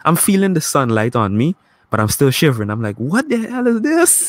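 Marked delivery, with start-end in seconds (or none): 3.0-4.3 incredulous. Retold or imitated. Visitor shivering in sunlight, high-pitched disbelief. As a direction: pitch it way up in mock outrage. Acts out shivering bewilderment in sunshine.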